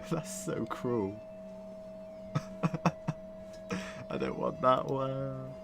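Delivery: talking through real laughter; can barely get the words out for laughing; laughing disbelief. Real, not acted. Laughing at the cruel line, words broken by laughter.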